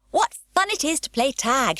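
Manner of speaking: high, bright gleeful call; a mild playful shout. Acted. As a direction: shout down from the chimney at them. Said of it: "shouted Pippi".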